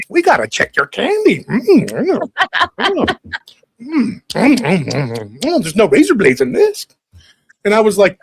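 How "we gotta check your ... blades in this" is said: high, put-on scavenger voice with munching hums; hammed-up nosy candy inspectors; greedy vultures. Mom and sister pawing through kid's candy, mock greedy vultures. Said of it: "she turns over these two vultures".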